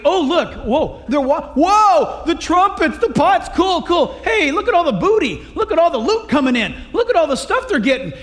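ooh and aah like greedy onlookers; gawking excitement. Retold or imitated. Ephraim gawking at the loot.

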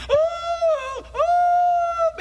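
high falsetto wailing ooh; mock hired mourner wail. Preacher mocking paid mourners wailing in the street, hammed-up high wail. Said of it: "when the body's going down the street".

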